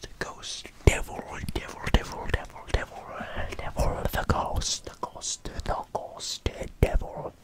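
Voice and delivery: ordinary talk, nothing marked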